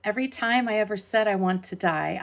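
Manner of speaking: declaimed first line, pressing onward; poem recitation. Acted. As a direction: launch into the poem with projection. Opening line of poem performed.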